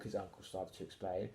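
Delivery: ordinary delivery, nothing marked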